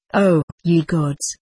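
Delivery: slow, weighty exclamation; a touch of passion, drawn out; mildly passionate cry. A narrator's reading. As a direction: stretch the cry out, keeping it restrained. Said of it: "cried she, passionately".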